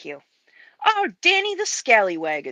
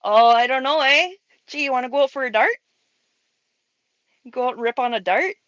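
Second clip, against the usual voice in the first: quick, higher Canadian accent with rising endings; 4 semitones higher, faster than usual. Shown as a demonstration. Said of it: "Canadians and Cholos both say "a".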